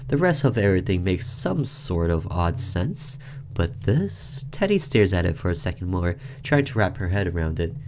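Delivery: ordinary talk, nothing marked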